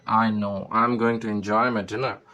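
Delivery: plain reading voice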